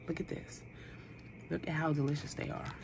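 hushed whisper over the treat; awed whisper. Real, not acted. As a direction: whisper it like a secret treasure. Showing brownies up close.

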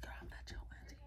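hushed unvoiced whisper. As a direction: whisper it so nobody outside hears. Hiding from visitor, whispering.

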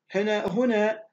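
normal talking voice

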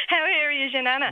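asked through laughter; can barely hold in the giggles; laughing question. Real, not acted. Receptionist laughing during call.

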